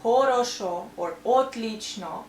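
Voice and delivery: "хорошо" and "отлично" with full O's as spelt; every O in "хорошо or отлично" kept as O; unreduced O. Shown as a demonstration. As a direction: say "хорошо" as kho-ro-sho, "отлично" as ot-leech-no. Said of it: "it sounds really strange".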